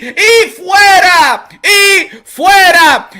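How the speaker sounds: high, loud announcer bellow; loud hype Spanish tirade at the hater; hype shout. Real, not acted. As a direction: shout the nicknames like a ring announcer. Hyping his show, chasing off hater.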